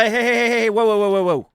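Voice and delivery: loud, high hey and whoa; shouting to break it up. Acted. Host breaking up a fight between co-hosts, loud. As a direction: cut in and stop the fight.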